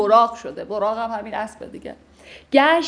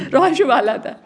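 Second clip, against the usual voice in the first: laughing; 3 semitones higher, 7 dB louder than usual. Real, not acted.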